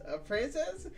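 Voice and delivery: mocking disbelief. Shown as a demonstration. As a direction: toss "A princess?" up high in disbelief.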